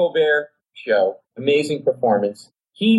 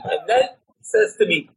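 ordinary talk

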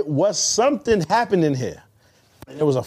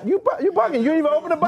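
excited teasing; 10 semitones higher than usual. Real, not acted. Teasing argument, pitch way up.

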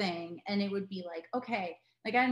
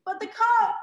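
higher, louder mock-dramatic cry over lost coke; mock despair, pitched much higher and louder; mock wail; 13 semitones higher, 9 dB louder than usual. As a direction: wail it like a hammed-up tragedy. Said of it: "and I was like".

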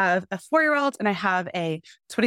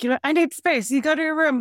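retold yell; 7 semitones higher than usual. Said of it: "So I'll yell and I'm like".

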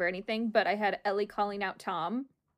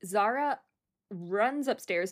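ordinary talk, nothing marked

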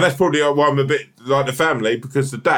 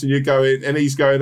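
ordinary talk, nothing marked

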